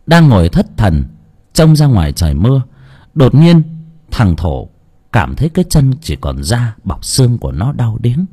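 plain reading voice

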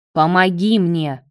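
plain reading voice

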